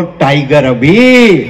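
drawn-out low slogan; slow, low rallying call. Real, not acted. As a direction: lead the crowd, stretching the words low. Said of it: "दोनों हाथ उठा के एक बार बोलो".

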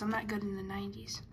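fast whispered admission to himself; whispered aside. Real, not acted. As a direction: mutter it under your breath quickly. Self-deprecating aside.

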